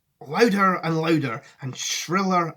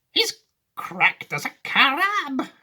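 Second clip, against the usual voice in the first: put-on high, drawn-out old woman; Grandma Georgina; 10 semitones higher, 4 dB louder, much slower than usual. Grandma calling Wonka mad, high and drawn-out. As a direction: exclaim it slowly in a high granny voice. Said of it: "said Grandma Georgina".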